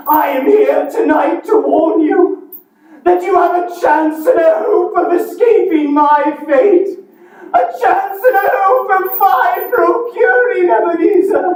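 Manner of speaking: high, eerie ghost voice; playing both parts. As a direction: wail it like a spectre. Marley's ghost warns.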